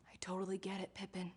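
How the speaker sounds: hushed whispered aside; whispering. Real, not acted. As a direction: whisper it to yourself. Aside about Pippin's search.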